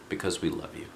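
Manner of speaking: soft whispered close; hushed and tender. Acted. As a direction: breathe it gently like a love note. Tender end of a dedication.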